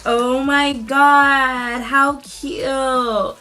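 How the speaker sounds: high, loud, drawn-out gushing; delighted squeal. Real, not acted. Seeing the cute brow tools her friend sent, delighted squeal. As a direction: squeal and stretch every word with delight.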